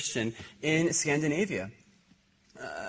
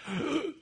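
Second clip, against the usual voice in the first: gasp; 14 semitones higher, 6 dB quieter than usual. Retold or imitated. Shocked gasp at swinging bottle.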